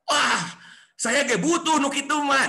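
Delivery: high, loud scoffing; dismissive brush-off. Retold or imitated. Successful friend dismissing the offer, high and loud. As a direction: wave it away like you don't need it.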